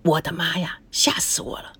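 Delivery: breathless whisper of relief; 如释重负的耳语; whispered to herself. A narrator's reading. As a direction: whisper it, heart still racing. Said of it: "心里说".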